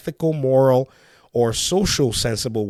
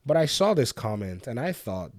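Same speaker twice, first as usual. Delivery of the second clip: ordinary talk, nothing marked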